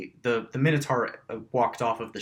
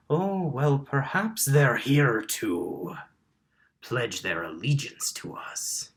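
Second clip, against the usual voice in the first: lightly voiced dragonborn, starting higher and easing down; red dragonborn; 3 semitones higher, slower than usual. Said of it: "a red dragonborn, says".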